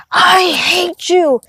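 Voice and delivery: high, drawn-out monster voice; losing it like a demon, each word stretched out; mock monster from hell, slow and strained; imitated demonic rage. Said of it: "the monster from hell, right? The demon".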